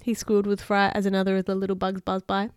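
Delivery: plain reading voice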